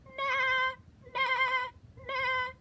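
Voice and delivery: loud high nah nah; high goat bleat. Shown as a demonstration. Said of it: "what do they say".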